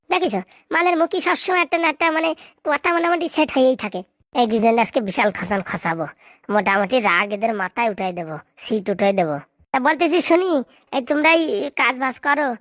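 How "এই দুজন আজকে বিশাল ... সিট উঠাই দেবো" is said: sly aside. Acted. Plotting aside to viewers.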